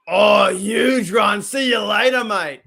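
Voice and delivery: loud, high shout, drawn out at the start; excited play call. Real, not acted. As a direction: shout as the runner breaks away. Calls a breakaway run in game.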